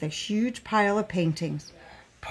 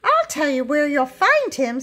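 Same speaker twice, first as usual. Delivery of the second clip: high, lively lady character; lady's voice; 7 semitones higher, 4 dB louder than usual. A narrator's reading. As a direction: give the helpful lady a raised pitch. Lady pointing out the mountain man.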